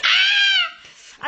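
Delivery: short, high shrieking scream. Retold or imitated. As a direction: let out a shriek of horror. People screaming in the scene.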